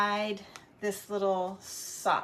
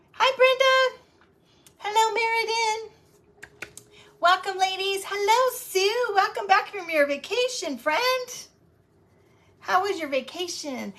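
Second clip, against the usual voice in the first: much higher, louder sing-song hellos; squealing welcome to returning friends; high excited greetings; 8 semitones higher, 5 dB louder than usual. Real, not acted. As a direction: greet each friend like a delighted host. Greeting arriving viewers by name.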